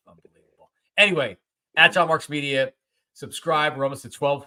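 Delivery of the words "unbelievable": breathy unvoiced grumble; whispered aside. Real, not acted. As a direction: mutter it under your breath. Grumbles about going to kid.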